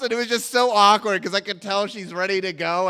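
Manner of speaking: usual speaking voice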